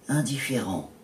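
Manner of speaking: ordinary delivery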